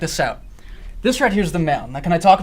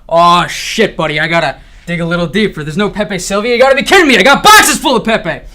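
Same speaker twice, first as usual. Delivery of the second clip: rising into a manic yell; frantic rant; 8 dB louder than usual. Acted. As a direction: spiral into loud panic. Frantic retold inner rant.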